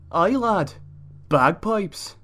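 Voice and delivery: a mock Scottish dad, proud and loud; Scottish accent. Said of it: "not gonna even attempt to try this whole video in a Scottish accent".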